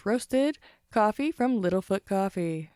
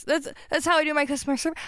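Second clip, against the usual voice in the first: ordinary talk, nothing marked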